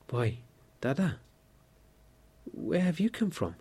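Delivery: a delighted gasp at seeing her brother; surprised. A narrator's reading. Sister recognising her long-lost brother, crying out in surprise. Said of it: "her anger was changed to surprise".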